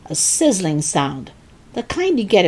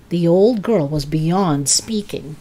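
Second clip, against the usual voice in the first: usual speaking voice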